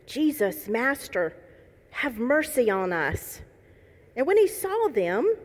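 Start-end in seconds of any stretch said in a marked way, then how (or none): none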